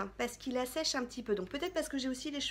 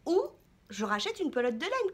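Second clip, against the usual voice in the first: ordinary talk, nothing marked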